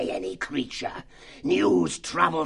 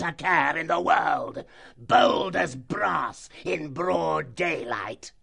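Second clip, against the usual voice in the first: higher, slow, shouted fox tirade; furious fox chief; 8 semitones higher, slower than usual. Acted. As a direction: dress him down with slow fury.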